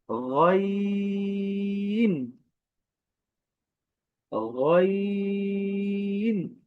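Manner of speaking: long sung গইন, throat sound held; teacher sings গইন slowly from the throat; drawn-out ghain. Shown as a demonstration. Arabic teacher drawing out the letter name. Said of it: "আমি আবারও বলছি".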